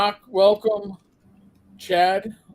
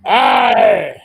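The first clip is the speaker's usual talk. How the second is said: pirate shout; 3 semitones lower, 11 dB louder than usual. Acted.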